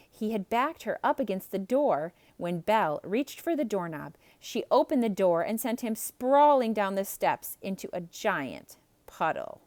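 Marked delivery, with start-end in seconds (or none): none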